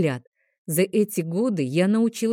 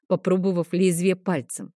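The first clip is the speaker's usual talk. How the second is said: ordinary delivery, nothing marked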